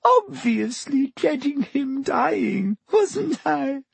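very high, slow, crying protest; tearful. A narrator's reading. Weeping Lavender justifies herself to Hermione, high and tearful. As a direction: wail it out through streaming tears. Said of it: "with streaming eyes".

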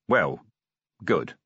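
grumpily. A narrator's reading. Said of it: "Vimes conceded grumpily".

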